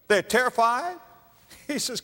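ordinary talk, nothing marked

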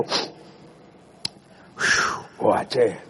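breathy whew then a low mutter; a man choking back tears, mock casual; embarrassed man hiding tears. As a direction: pretend it's just a cold, hide the tears. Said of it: "They go".

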